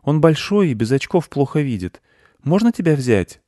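put-on high boy's question; high-pitched young boy asking gently; little boy. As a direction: ask in a high child voice. Boy talks to beetle.

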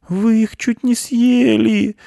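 high, loud wailed mouse complaint; tearful, drawn-out squeak; crying. A narrator's reading. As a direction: wail it slowly like a weeping mouse. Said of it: "плакал мышь".

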